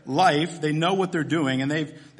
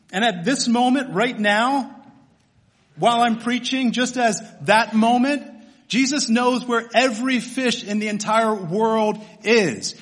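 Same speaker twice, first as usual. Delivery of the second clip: raised, louder voice with punched key words; impassioned preaching; 5 semitones higher, 4 dB louder than usual. Real, not acted. Preacher declares the creator knows every fish, impassioned and forceful. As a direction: drive each phrase home to the congregation.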